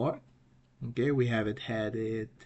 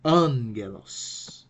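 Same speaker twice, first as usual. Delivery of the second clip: "angelos" as AN-ge-los, first syllable raised; "angelos" with a strong long AN opening; accent on first syllable; 4 dB louder, much slower than usual. Shown as a demonstration. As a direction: punch AN in "angelos", then fall away. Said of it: "So the right pronunciation".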